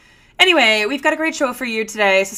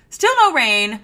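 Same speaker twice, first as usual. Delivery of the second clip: usual speaking voice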